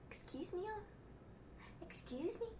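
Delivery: hushed, high rising question; mock disbelief. Reacting to full stadiums in Florida, mock disbelief. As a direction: ask very softly, pitch climbing in incredulity. Acted disbelief at billion fans.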